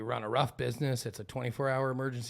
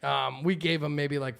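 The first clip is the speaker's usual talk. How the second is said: ordinary talk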